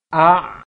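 "A" loud and high, glottal stop then throaty ain; hamzah then ain. Shown as a demonstration. Teacher demonstrating hamzah followed by ain, loud. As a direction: separate the hamzah before the ain. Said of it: "kemudian ain".

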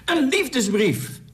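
high, drawn-out delighted cry; moved exclamation. A narrator's reading. Loftus realises what Anna's letter is, moved cry. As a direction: cry it out slowly, voice leaping high with emotion. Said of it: "riep Loftus ontroerd".

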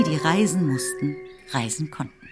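ordinary talk, nothing marked

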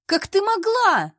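high, loud, drawn-out accusing screech; unpleasant shriek. A narrator's reading. Colleague confronting the heroine in the office, high accusing shriek. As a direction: shriek it in outrage. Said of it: "Неприятный визг заставил скривиться".

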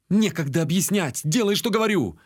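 sharp, high-pitched bark; urgent snapped command; barked order. A narrator's reading. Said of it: "рявкнул я".

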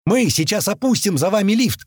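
shouting. A narrator's reading. Telling the men as doors close, shouting. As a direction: shout it to a crowd. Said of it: "кричу мужикам".

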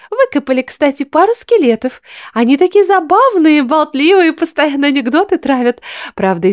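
high, bright excited chatter; chirpy excited girl. A narrator's reading. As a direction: gush about the skeletons. Darla chatters, voiced character.

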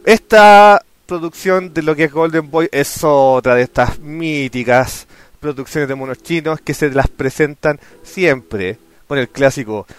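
ordinary talk

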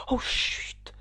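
whispered, alarmed shushing; frightened hush. A narrator's reading. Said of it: "tout bas le lapin d'un ton effrayé". (chuchotement effrayé)

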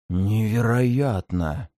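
slow, low hoarse demon voice. Acted. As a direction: squeeze it out hoarsely. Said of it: "сипло выдавил он из себя".

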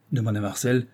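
usual speaking voice

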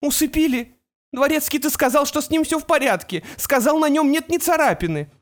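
high, sharp and accusing; alarmed mother. A narrator's reading.

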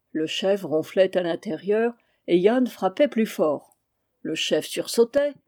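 ordinary delivery, nothing marked

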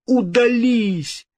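high, drawn-out cry; frightened outcry. A narrator's reading. King banishing the vision, crying out. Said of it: "вскричал Генрих".

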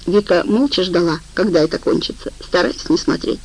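usual speaking voice

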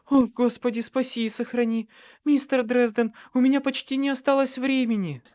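high, loud, urgent woman's plea; moaning in labour. A narrator's reading. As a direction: plead through pain, pitched high. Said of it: "простонала Черити". (высокий, страдальческий, испуганный голос)

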